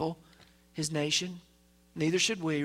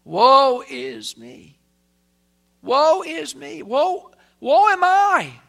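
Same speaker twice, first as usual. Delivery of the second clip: loud, slow lament climbing higher with each woe; Isaiah crying out; 7 semitones higher, 10 dB louder, much slower than usual. Acted. Preacher voicing Isaiah's anguished cry, loud and slow. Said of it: "That's what Isaiah said".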